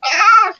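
high imitated scream; mock pained yelp. Boy being whipped by his dad, mock high scream. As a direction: yelp like a kid getting whipped.

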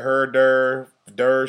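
drawn-out mock Saint Louis accent. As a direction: imitate Saint Louis vowels, slowly. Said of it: "Saint Louis".

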